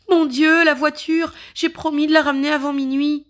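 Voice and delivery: high, loud, rushing alarm; panicked. Acted. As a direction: blurt it out in a fast panic. Cambouis realizes time.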